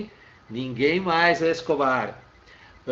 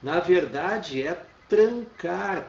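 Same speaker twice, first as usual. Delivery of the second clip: "na verdade é trancar," drawn out, weight on "trancar"; slow emphatic; 3 semitones higher, much slower than usual. Shown as a demonstration. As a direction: stretch "trancar" to make the point. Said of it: "quando eu digo que é encerrar".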